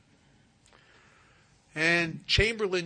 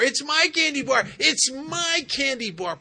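put-on much higher, much louder child's yell; stubborn little girl shouting; 11 semitones higher, 11 dB louder than usual. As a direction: scream it like a kid clutching her candy. Stubborn girl refuses paramedic.

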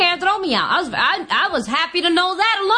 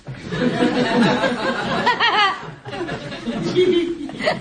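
long laugh. Real, not acted. Laughing at own story.

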